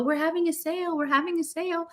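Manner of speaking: lightly voiced robot calling out a sale; a hint of a cheery shop robot waving people in; robot sales pitch. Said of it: "and wave people in and go".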